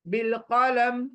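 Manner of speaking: बिल कलम closed on a silent meem; stopped meem. Shown as a demonstration. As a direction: drop the final i of बिल कलम. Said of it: "मीम को साकिन बना दूंगी".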